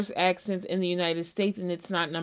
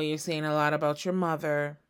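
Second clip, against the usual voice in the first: ordinary delivery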